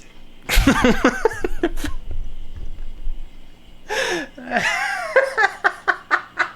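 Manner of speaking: helpless high laughter; wheezing laugh till tears; laughing hard. Real, not acted. Long crying laugh.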